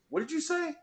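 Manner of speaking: acted-out offended question; retold affront. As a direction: ask it high and quiet, not believing the nerve. Retold challenge to side judge.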